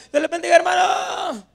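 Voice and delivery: high holler, last word stretched out; shouted greeting. Acted. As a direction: shout it out, even from the grave. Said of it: "les voy a gritar".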